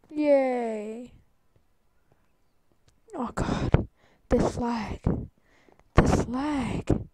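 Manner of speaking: usual speaking voice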